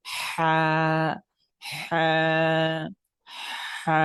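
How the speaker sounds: "হা" from the middle of the throat, held long; throaty Arabic "হা". Shown as a demonstration. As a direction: draw out a breathy h in "হা" from mid-throat. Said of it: "এখান থেকে মূলত এটা উচ্চারণ হয়ে থাকে".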